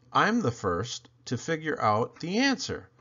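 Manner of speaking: plain reading voice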